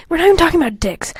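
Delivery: fast whispered aside. Real, not acted. Aside to viewers while reading, whispered fast. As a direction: hiss it fast under your breath.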